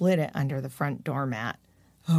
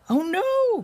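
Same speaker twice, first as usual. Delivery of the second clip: high alarmed cry; a young critter yelping in alarm; high squeaky cry of dismay; cried out; 14 semitones higher, 4 dB louder than usual. Acted. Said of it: "cried Morty".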